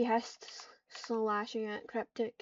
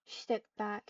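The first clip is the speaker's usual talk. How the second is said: ordinary delivery, nothing marked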